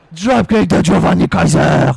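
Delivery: slow, loud dropkick call; big hyped ringside call; commentator shout. Real, not acted. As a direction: roar the move out. Calls a dropkick.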